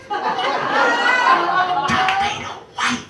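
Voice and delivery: put-on high, very slow drawn-out kid's voice. A shocked child staring at Darth Vader's bare hands, high and drawn out. Kid saying it slowly, drawn out.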